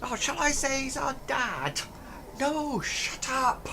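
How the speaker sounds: high hushed goblin scolding. Acted. Goblin hushing his partner in front of the players. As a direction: hush him urgently. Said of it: "the other one says".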